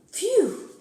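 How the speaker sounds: high, loud burst of relief; relieved phew. Acted. Child hears her own monster return, loud and relieved.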